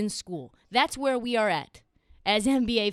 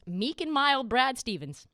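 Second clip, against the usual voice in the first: ordinary talk, nothing marked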